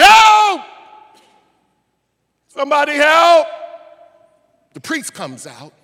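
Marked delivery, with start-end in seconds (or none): 0.0-3.5 high, loud shouted plea for help; desperate cry. Retold or imitated. Man left for dead begging passersby, screaming.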